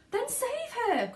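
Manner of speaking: very high, desperate cry; a mother begging for rescue. A narrator's reading. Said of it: "cried Missus Salt".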